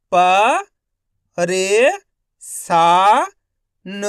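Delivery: each syllable called out loud and separate. Shown as a demonstration. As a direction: sound out every syllable slowly with a pause. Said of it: "एक एक अक्षर का उच्चारण करके".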